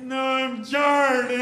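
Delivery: put-on very slow, high, loud child's voice; small boy correcting the teacher; little kid insisting. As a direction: insist on Chard like a stubborn five-year-old.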